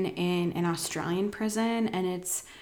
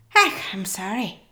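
put-on Irish accent; 7 dB louder than usual. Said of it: "my fantastic Irish accent".

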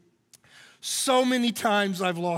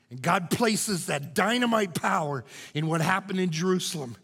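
usual speaking voice

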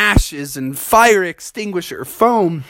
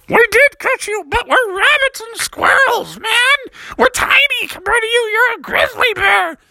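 very high squeaky critter voice; tiny animals. Acted. Rabbits and squirrels tell the bear he is huge, squeaky.

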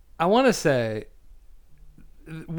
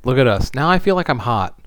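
talking loud into a hot mic; loud, close to the mic; 8 dB louder than usual. Real, not acted. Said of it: "my mic is hot".

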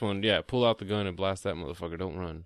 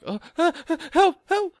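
hammed-up shrieking cry for help, much higher and louder; mock panicked cry; 9 dB louder than usual. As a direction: mimic her panicking on the radio. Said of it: "she's just, like, panicking".

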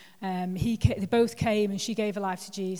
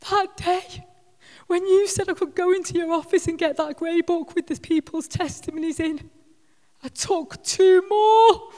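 imitating sobbing Davina; 9 semitones higher, 8 dB louder than usual. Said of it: "And she's sobbing".

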